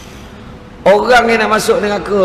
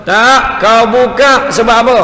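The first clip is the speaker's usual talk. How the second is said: loud, higher, forceful retort; emphatic divine command; 6 semitones higher, 5 dB louder than usual. Retold or imitated. God overruling the angel, loud emphatic voice. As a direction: cut off the objection with authority. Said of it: "Allah Ta'ala kata".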